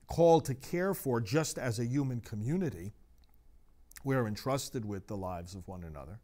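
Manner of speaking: ordinary talk, nothing marked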